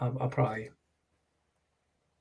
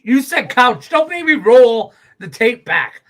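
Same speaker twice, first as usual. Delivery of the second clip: mock accusation; 7 semitones higher, 11 dB louder than usual.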